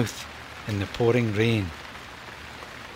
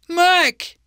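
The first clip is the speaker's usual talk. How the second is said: shrill-pitched alarmed cry, much higher and louder; high scream; 5 dB louder than usual. A narrator's reading. Wife screams for her husband at the croft, high alarmed cry. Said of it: "Missus McDougall gave a scream".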